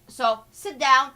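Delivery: slow, loud order to sit down; retold command. The prince ordering the reporter to sit down, loud and slow. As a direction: say each word apart and loud. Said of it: "He said".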